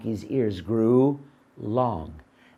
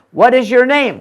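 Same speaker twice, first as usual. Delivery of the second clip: exasperated shout; 10 semitones higher, 12 dB louder than usual. Acted. Man demanding, loud.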